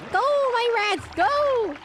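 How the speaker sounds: high, drawn-out playful battle cry; rallying cry. Pretend. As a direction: send your minions charging. Commands rats in game.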